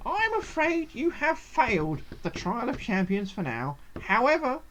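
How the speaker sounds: lilting gnome voice, a little high; gnome trial master. Acted. Gnome telling adventurer they failed, lilting theatrical voice. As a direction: deliver the verdict as a theatrical gnome.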